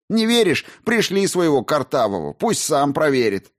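indignant protest; heated defiance. A narrator's reading. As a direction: dare her to check, heatedly. Shouted denial in a quarrel.

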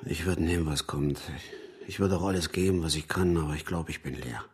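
low, fading admission; defeated, very low and increasingly soft; drained resignation. Acted. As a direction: sink low and trail away, emptied out. Defeated confession after quarrel.

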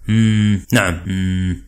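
low, soft lowing grunt like a bull; bellowing rumble. A narrator's reading. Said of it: "ودمدم بصوت كالخوار".

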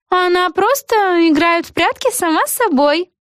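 high-pitched character voice; interjecting witch. A narrator's reading. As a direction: chime in high over the speech. Eggi's interjection continues.

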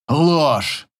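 mildly emphatic denial; exclamation. A narrator's reading. Ex-husband denies mother's charge, mild exclamation. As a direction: exclaim it as one firm accusation. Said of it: "восклицает".

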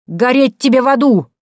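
high, loud damning shout; furious curse. A narrator's reading. Butcher's wife cursing the doorman, loud furious shout. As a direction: curse him to hell at full voice.

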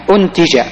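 أنتج as untija, opening on "u"; damma on alif. Shown as a demonstration. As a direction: say أنتج with a short u, then ti-ja. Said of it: "تقرأ بالضم".

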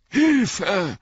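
high, loud yell for help; cry for help. Acted. Hobbits calling to the stranger for help, high loud yell. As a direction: shout it desperately, voice cracking high. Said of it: "riefen Frodo und Sam".

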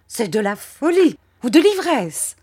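high, loud exasperated cry; high shrieking spinster at her wits' end; desperate Miss Murdstone. A narrator's reading. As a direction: fling the accusation in despair. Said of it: "au désespoir".

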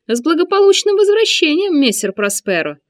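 high, loud sneering greeting; mock him with a high voice; mocking eunuch. A narrator's reading. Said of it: "насмешливо приветствовал его евнух".

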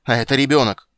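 loud, high outraged retort; angry retort. A narrator's reading. Witcher argues with dryad over the child, angry retort. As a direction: throw it back at them loudly.

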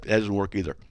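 ordinary talk, nothing marked

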